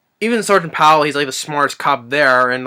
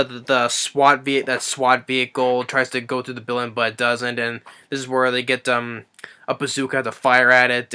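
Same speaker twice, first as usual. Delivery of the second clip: normal talking voice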